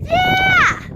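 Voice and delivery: high, loud cheer; excited shout. Real, not acted. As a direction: shout for joy at the top. Cheering at the last pass.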